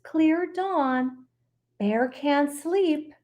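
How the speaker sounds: lively read-aloud voice; storytelling. Acted. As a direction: read it to kids with gusto. Reads picture book aloud.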